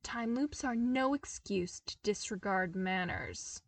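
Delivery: usual speaking voice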